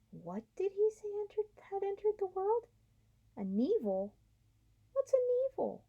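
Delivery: high, confused creature chatter; baffled beasts asking each other; puzzled high animal voices. Acted. New animals asking about the evil, high and baffled.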